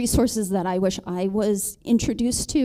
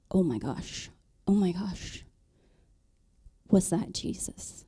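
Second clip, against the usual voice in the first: hushed, breathy whisper of awe; awed whisper; 6 dB quieter than usual. Retold or imitated. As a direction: whisper it as if realizing something holy.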